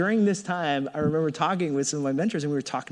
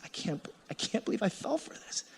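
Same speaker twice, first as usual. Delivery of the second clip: whispered disbelief; 6 semitones higher, 9 dB quieter than usual. Retold or imitated. Spiraling jealous thought.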